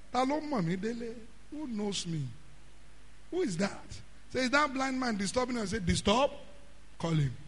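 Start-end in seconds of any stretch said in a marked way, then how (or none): 0.1-3.9 much softer, calm asking; Jesus quietly asking who called; hushed question. Retold or imitated. Jesus hears and asks. 4.4-5.7 soft question. Retold or imitated. Jesus asks about the blind man. 5.9-7.4 calm quiet command. Retold or imitated. Jesus orders the man called.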